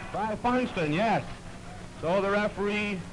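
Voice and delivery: ordinary talk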